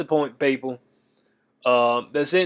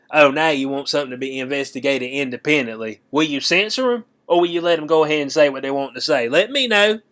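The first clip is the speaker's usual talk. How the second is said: normal talking voice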